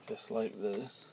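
usual speaking voice

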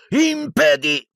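high yell, cut short; shouted spell. A narrator's reading. Harry casting before pain stops him, shouting. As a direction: break off a shouted incantation.

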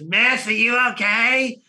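high, drawn-out put-on woman's call; alarmed bystander. Lady at next table checking on his date, alarmed. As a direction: imitate a concerned stranger calling out slowly. Neighbouring diner calls to the date.

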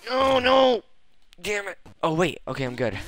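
normal talking voice

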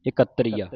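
ਇਕੱਤਰੀਆ with the ਕ doubled and held tight; ਇਕੱਤਰੀਆ as the correct reading; with tight adhak. Shown as a demonstration. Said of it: "ਅੱਧਕਾ ਲਾ ਕੇ ਕੱਸ ਕੇ ਬੋਲਣਾ ਹੈ".

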